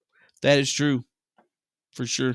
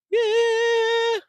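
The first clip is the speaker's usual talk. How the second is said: high squeal. Pretend.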